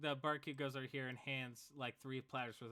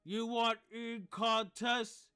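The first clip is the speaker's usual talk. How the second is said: odd lizard folk voice; 10 semitones higher, 10 dB louder, much slower than usual. Acted. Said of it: "He goes".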